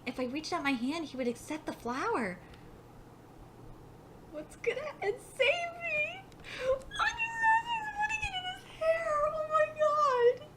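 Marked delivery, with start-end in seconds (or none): 4.3-10.5 very high, thin crying whine; tearful overwhelmed squeal. Real, not acted. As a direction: squeak it through happy tears. Tearful fan reaction to the scene.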